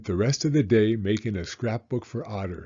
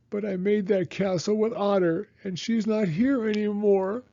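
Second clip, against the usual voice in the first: much higher, tearful plea; sobbing; 11 semitones higher than usual. A narrator's reading. Franklin telling his father about Otter, sobbing. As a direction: let the words break with grief. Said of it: "sobbed Franklin".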